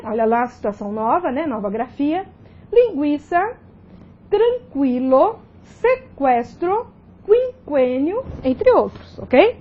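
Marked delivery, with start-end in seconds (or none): 2.7-8.2 Linguiça, tranquilo, sequestro, quinquênio slowly dictated, each U as a w; spaced-out word list: Linguiça, tranquilo, sequestro, quinquênio with gw and kw; U pronounced. Shown as a demonstration. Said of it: "a pronúncia não foi alterada".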